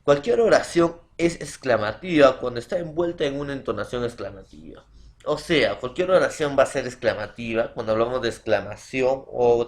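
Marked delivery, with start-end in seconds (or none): none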